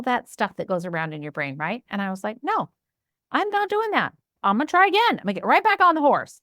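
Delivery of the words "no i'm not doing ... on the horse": defiant pep talk to herself, much higher; determined. Retold or imitated. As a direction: talk yourself back onto the horse, fired up. Defiant answer to inner critic.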